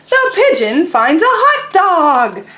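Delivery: loud, slow exclamation; read really loud. Shown as a demonstration. Teacher reading the exclamation title loudly to the class. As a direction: belt out the title, slow and big. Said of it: "I say that really loud".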